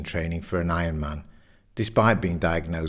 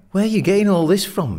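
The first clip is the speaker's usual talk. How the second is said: fast, high, loud question; exasperated demand; 12 semitones higher, 6 dB louder, faster than usual. A narrator's reading. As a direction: demand an answer from your mate, fast and pitched up. Simon demands source of Richard's views.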